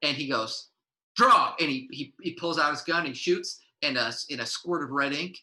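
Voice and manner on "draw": loud, high call to draw; gunslinger challenge. Acted. Gunslinger Red Ink calling out the trash, loud. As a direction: bark it out like a western duel. Cowboy showdown in game.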